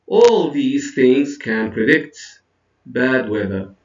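teacher model with a clear final descent on "weather"; closing-statement intonation. Shown as a demonstration. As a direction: hold the tune up through "predict", then let "bad weather" fall. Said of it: "notice the intonation".

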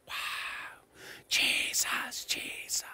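breathless, unvoiced whisper of awe; awed whisper. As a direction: whisper the name in wonder. Crowd awed by the Lion.

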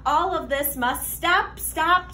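plain reading voice

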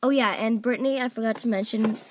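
usual speaking voice

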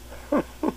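put-on soft, breathy old man's chuckle; laughing quietly at his own joke. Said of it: "He laughed quietly to himself".

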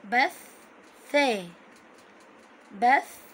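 "بث" split as bath, then tha; letter pronounced twice. Shown as a demonstration. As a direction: linger on the th of "بث", pause, then tha. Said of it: "نطقناها مرتين".

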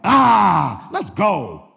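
loud drawn-out cry, then dropping back; mock shout of excitement; excited outburst. Preacher retelling his reaction to a picture, excited outburst.

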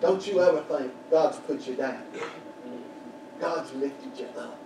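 ordinary talk, nothing marked

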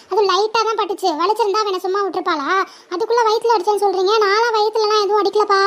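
put-on a whiny kid insisting she did nothing; little girl pleading she only kicked lightly; defensive little sister. Younger sister defends herself.

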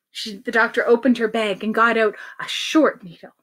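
ordinary delivery, nothing marked

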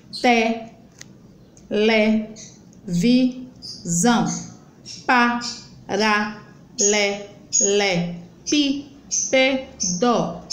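each syllable a separate beat, te, le, vi, zão. Shown as a demonstration. Teacher opening the mouth many times, syllables spoken apart. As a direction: chop the words into evenly spaced single syllables. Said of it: "abrir a boca várias, várias, quatro ou mais vezes".

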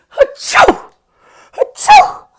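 high loud mock sneeze; sneezing. Shown as a demonstration. Teacher showing kids a sneeze, mock sneezing. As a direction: fake a big achoo. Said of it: "a sneeze".

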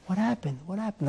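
barely above a murmur, calm and modest; humble man woken, answering calmly. Retold or imitated. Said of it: "Like, very calmly".

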